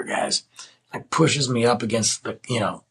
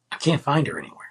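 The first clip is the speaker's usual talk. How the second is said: ordinary talk, nothing marked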